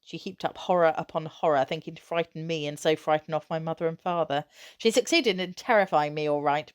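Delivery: usual speaking voice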